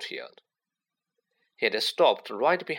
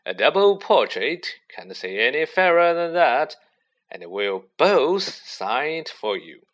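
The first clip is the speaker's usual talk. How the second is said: grand showman; 3 semitones higher, 6 dB louder than usual. A narrator's reading. Lockhart voiced loud, grand.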